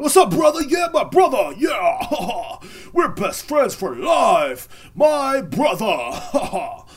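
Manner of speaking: loud, high, fake-laughing bro voice. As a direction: gush with hammed-up best-friend excitement.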